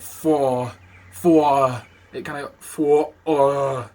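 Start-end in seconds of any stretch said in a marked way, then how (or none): 0.0-1.8 loud chesty Walken "Four, four"; Walken rumble. Retold or imitated. Imitating Timmy's Walken, loud chesty "Four". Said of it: "but he's like". 2.6-3.9 loud rumbling "Four" from the chest; deep chest rumble. Shown as a demonstration. Showing where the sound sits, loud chesty "Four". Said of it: "down there like that".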